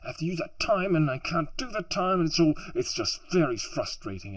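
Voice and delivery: real frustration. Real, not acted. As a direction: vent your mounting frustration. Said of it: "you can hear my frustration".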